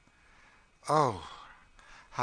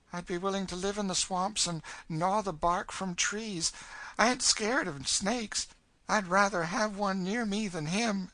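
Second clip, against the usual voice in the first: put-on high, louder, eager girl's voice; 12 semitones higher, 5 dB louder than usual. A narrator's reading. Girl willing to brave the swamp, high eager insistence. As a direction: insist eagerly, pitched well up. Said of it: "said Emmeline eagerly".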